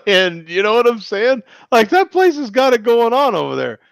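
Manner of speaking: high, lively praise; excited. Real, not acted. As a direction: gush about the place.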